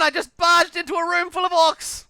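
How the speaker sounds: loud high laughing self-scolding yell; self-mocking yell. Real, not acted. Gamer mocking his own mistake, loud yell. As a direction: cry out at your own blunder. Barged into orcs again.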